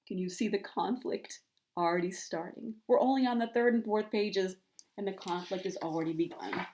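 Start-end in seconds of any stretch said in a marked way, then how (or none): none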